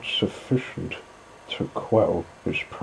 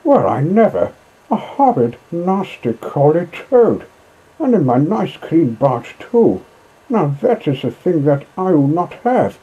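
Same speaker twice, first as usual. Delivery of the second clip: much higher, louder indignant squawk; outraged barge woman; 7 semitones higher, 6 dB louder than usual. Acted. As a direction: scold the horrid toad.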